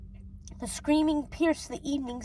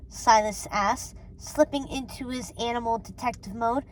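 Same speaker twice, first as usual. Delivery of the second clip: ordinary delivery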